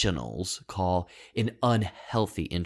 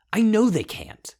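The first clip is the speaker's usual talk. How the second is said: sharp high defiance; 12 semitones higher, 4 dB louder than usual. Acted. Defiant monologue climax.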